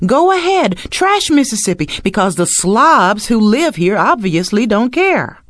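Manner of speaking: high, loud taunting jeer; sneering litter voice. Acted. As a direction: taunt like careless trash. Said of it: "Litter on our highways says".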